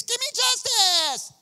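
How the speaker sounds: loud, high, slow demanding shout; hammed-up widow crying for justice. Widow harassing the judge every morning, loud demanding cry. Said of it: "She's like".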